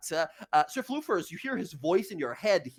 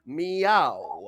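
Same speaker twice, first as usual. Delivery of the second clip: playful meow, drawn out; mock cat meow; 2 semitones lower, 5 dB louder than usual. Familiar lures guards away, mock meow. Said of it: "he goes".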